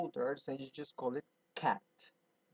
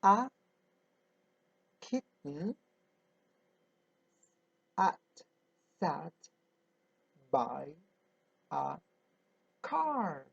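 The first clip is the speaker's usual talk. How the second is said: slow word-by-word reading. Shown as a demonstration. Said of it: "It says".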